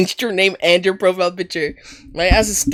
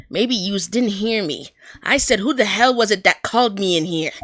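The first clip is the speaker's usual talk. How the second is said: put-on Brooklyn accent. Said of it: "really bad at writing accents".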